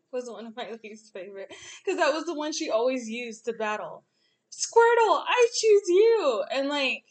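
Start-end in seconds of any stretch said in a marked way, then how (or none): 4.5-6.4 trainer calling out. Retold or imitated. Misty calling out her Pokemon.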